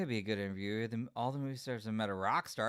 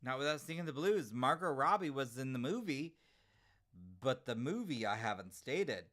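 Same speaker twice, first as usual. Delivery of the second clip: ordinary talk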